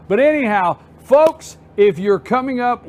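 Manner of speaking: ordinary talk, nothing marked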